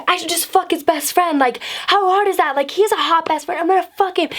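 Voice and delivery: high-pitched, fired-up rant at normal volume; retold angry yelling. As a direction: act out ranting to your girlfriends, pitch way up. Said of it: "I'm, like, so fucking mad".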